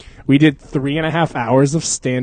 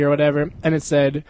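ordinary talk

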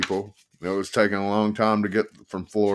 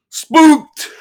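very high, loud triumphant shout; gleeful loud shout; 12 dB louder than usual. Acted. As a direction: crow it out. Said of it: "he shouted".

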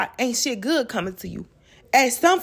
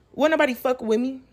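ordinary talk

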